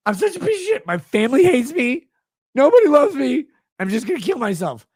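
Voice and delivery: high whiny mocking sob-story voice; mock whine. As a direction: lay the self-pity on thick. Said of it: "Texting me this".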